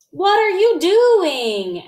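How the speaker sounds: high, loud and slow; startled question. A narrator's reading. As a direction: stretch it out in disbelief. Mother's startled question to son.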